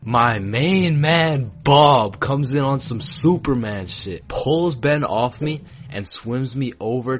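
loud, high, slow hero announcement; triumphant hype. Retold or imitated. Telling how Bob pulled Ben off, loud and triumphant. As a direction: hype up the rescuer with booming gusto.

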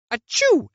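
high loud mock sneeze; fake sneeze. Mom pranking her child, mock sneeze. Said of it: "she would turn to me and go".

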